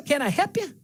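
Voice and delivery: "help" said without the L, as "hep"; Natchez accent. Shown as a demonstration. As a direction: drop the L in "help", Natchez style. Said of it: "They take the L out".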